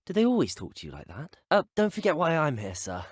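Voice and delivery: ordinary delivery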